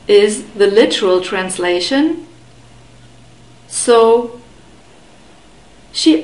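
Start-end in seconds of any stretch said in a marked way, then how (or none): none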